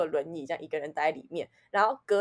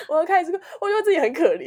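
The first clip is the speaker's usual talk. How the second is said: higher and louder, fast, laughing through tears; laughing while half crying; 9 semitones higher, 6 dB louder, faster than usual. Real, not acted. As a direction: laugh at your own self-pity. (边笑边带哭腔，语速快，声音高)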